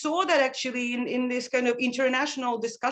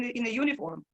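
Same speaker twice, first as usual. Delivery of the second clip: ordinary talk, nothing marked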